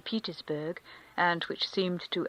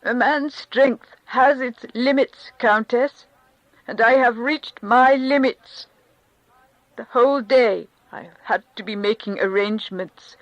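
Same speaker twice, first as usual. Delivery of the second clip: distressed Karenin; 3 semitones higher, 8 dB louder than usual. Acted. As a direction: pour out his burden, near breaking. Karenin's long complaint.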